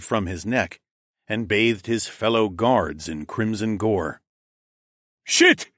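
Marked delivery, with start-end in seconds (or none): 5.2-5.7 high, loud panicked curse; cried out. A narrator's reading. Second guard cries out in panic, high and loud. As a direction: blurt it out in shock. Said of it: "the other one cried out".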